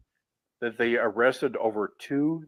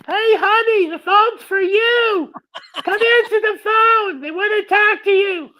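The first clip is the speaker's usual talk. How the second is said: very high, loud put-on wife voice; shrieky falsetto calling across the house; Betty; 8 dB louder than usual. Calling honey to the phone, loud high falsetto holler. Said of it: "I answered as Betty".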